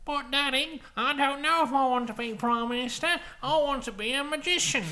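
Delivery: put-on high whiny little boy voice; child begging his father, high pleading; young boy. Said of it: "And Scott's a young boy".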